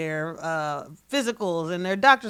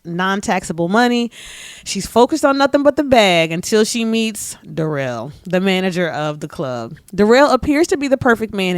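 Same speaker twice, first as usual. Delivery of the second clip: louder, promo-style blurb read; book blurb voice; 6 dB louder than usual. Shown as a demonstration. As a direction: sell the book like a trailer. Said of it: "put on your voice".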